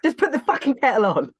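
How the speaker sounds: loud, high-pitched comic order; hammed-up irritated command; mock exasperation. Joining in the acted row.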